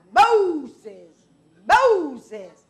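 loud, ringing call of the name; booming call. Shown as a demonstration. Preacher voicing God calling from the bush, loud call. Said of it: "I like saying it like that".

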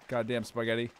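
ordinary talk, nothing marked

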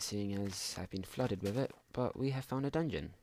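normal talking voice

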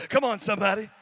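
loud call to the crowd; loud church holler; preacher's shout. Real, not acted. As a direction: rouse the room, come on somebody. Preacher calling on congregation.

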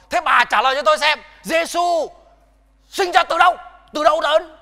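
high, loud, fast challenging shout; retold angry demand. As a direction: bark the question at her like a hostile old man. Old man demands an answer.

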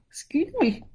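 stifling a yawn mid-word. Real, not acted. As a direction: excuse me through a yawn. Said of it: "Caught me yawning".

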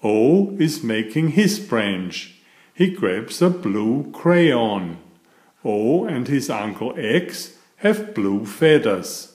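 ordinary delivery, nothing marked

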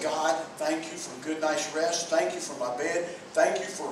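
soft, hushed thanks to God on waking; quiet morning prayer. Shown as a demonstration. Preacher demonstrates a waking prayer for his church, hushed and reverent. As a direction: pray it gently before your feet touch the floor. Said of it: "stop and say".

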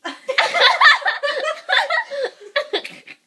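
bursting out laughing; high burst of laughter; loud high laugh. Real, not acted. Laughing at her own story.

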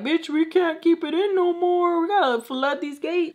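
high-pitched mock voice of the body; hammed-up high complaint; body talking back. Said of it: "your body is like".

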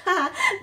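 loud, high taunting laugh; mocking bear laugh. A narrator's reading. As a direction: cackle at the runty gosling. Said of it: "Nettle laughed".

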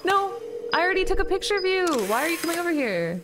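high frightened yelling; panicked shout. Real, not acted. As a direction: yell in panic. Monster approaching.

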